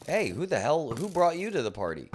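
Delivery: startled angry shout at a party crasher; indignant yell. Real, not acted. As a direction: yell at the intruder. Mob attacks in game.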